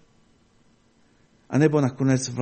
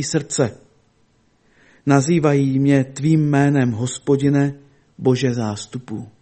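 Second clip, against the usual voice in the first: scripture reading; 5 dB louder than usual. Acted. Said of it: "Je to v patnácté kapitole v šestnáctém verši".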